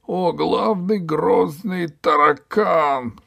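high, slow, loud wailing praise; grovelling cry. Acted. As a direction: wail it face down in the sand. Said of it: "кричал он". (высокий протяжный угодливый вопль)

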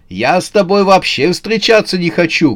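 high-pitched protesting cry; shouted refusal. A narrator's reading. Новый год rejects Январь as he flees, shouting. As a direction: shout it back while escaping. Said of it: "крикнул Новый год".

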